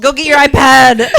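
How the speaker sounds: loud, drawn-out playful order; slow mock-stern shout. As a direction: send the kid off loudly. Mock ordering a kid away.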